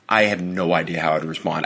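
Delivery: ordinary delivery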